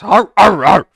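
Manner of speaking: loud, high-pitched hound barking; dog bark. Acted. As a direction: bark out three times like an attacking hound. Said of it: "he barks".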